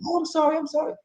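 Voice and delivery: high soft squeaky sorry; meek mock apology. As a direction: whimper a timid apology. Mock apology after outburst.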